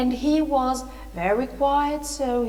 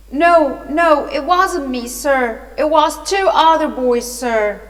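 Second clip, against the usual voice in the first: loud, raised, pleading denial; terrified protest; 4 semitones higher, 6 dB louder than usual. A narrator's reading. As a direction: protest innocence urgently to a policeman. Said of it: "really terrified".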